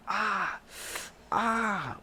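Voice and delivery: high ah-ah of pain; mock cry of pain; pained moan. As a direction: wince as they lift him. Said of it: "I'm like".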